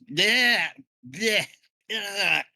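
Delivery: gargled yeah. Acted. Character voiced after spitting seawater.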